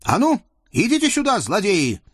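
high, fast, commanding cry to come down; grozny call. Acted. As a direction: shout them down off the tree. Казачка зовёт чертей спуститься. (гневный высокий окрик)

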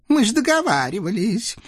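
high, begging little devil voice; pleading devil. Acted. Devil Naum begs the Cossack to let him go, high and whiny. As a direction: whine for release like a small imp.

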